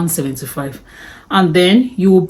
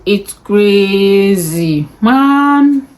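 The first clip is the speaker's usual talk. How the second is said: drawn out in disbelief, the last word squeaked high and loud; drawn-out exclaim; 4 semitones higher, 4 dB louder, much slower than usual. Real, not acted. Vlogger marvelling at her own driving license costs, slow disbelieving exclaim. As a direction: stretch it slowly, then jump way up on man. Exclaiming over total cost.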